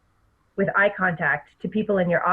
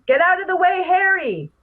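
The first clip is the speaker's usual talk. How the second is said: loud high yell; 11 semitones higher, 4 dB louder than usual. Shown as a demonstration. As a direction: shout it out. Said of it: "So I had yelled it".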